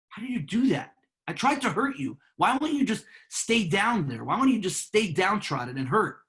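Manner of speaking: high-pitched, incredulous protest; imitating a baffled, thwarted tormentor; exasperated bully. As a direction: demand it in mock disbelief, pitch swooping up.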